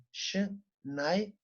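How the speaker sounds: Schneider slowly, Sch as ş and ei as ay; German Schneider. Shown as a demonstration. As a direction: stretch Schneider out, Şnayder. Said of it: "ay sesi çıkarıyor". (Schneider'i yavaşça Şnayda diye)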